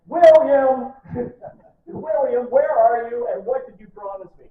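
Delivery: high, loud calling out for William; mock shout at husband. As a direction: yell across the camp, half-joking. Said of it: "she was like".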